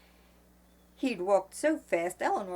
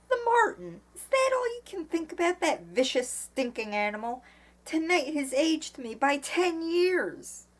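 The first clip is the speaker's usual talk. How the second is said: high, indignant outburst; voice rising in anger; 10 semitones higher, 4 dB louder than usual. A narrator's reading. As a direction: rant in outrage, voice pitched up. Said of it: "Eleanor's voice was rising again".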